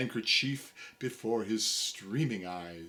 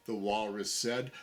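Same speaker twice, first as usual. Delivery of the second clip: ordinary delivery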